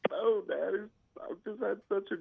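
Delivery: teary daughter. Retold or imitated. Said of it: "she's crying".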